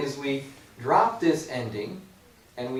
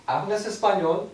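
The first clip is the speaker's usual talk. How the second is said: "¿Hablas español?" at normal speed, higher, rising on español; natural question; 5 semitones higher, 3 dB louder than usual. Shown as a demonstration. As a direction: ask it the way a native speaker would, higher. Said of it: "I hear".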